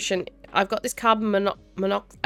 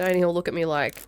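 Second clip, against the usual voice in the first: ordinary talk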